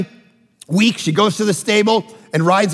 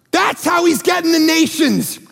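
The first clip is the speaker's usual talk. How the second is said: ordinary talk, nothing marked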